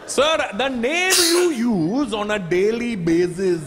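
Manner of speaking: very slow, high, overly patient agent; slow Indian accent. Retold or imitated. Agent slowly explains a first name to the caller in a patient Indian accent.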